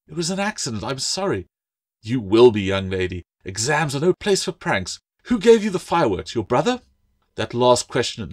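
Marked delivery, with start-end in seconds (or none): 0.1-1.5 put-on high-pitched girl's apology; small, high, apologetic voice; pleading apology. A narrator's reading. Girl apologising to an angry teacher. 2.0-6.8 high, indignant teacher's telling-off; sharp schoolmistress reprimand; stern scolding. A narrator's reading. Mistress scolding and interrogating a pupil.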